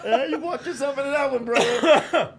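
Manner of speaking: teasing catchphrase. Retold or imitated. Co-host echoes the brother's catchphrase.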